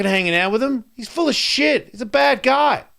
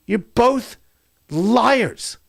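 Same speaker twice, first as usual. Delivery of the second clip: normal talking voice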